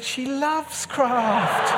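high, drawn-out gushing fib; hammed-up eager assurance; mock enthusiasm. Fibs about wife, retold for laughs.

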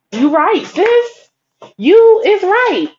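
high, loud, drawn-out hype agreement; emphatic agreement. Retold or imitated. Woman wholeheartedly agreeing with her friend's pep talk, emphatic. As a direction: agree with your girl in a rising sing-out.

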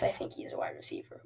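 ordinary delivery

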